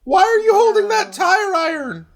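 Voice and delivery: squeaky high-pitched teasing; high mock voice.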